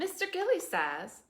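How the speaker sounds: usual speaking voice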